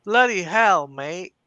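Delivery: a loud British exclamation, rough and hearty; Constantine British accent. Pretend. Asked to do Constantine, a loud British bloody hell. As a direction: play Constantine in your native British accent. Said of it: "Do your Constantine".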